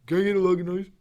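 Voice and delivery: slurred Can I get a Lagunitas; mock the blurred order; mocking Jim's unclear bar order; mumbled order. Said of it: "She probably just heard the".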